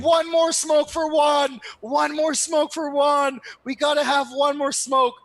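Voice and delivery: put-on high, loud, slow drunken toast shouting for Juan; drunken cheer. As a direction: raise a rowdy cheer to the dead friend. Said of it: "being like".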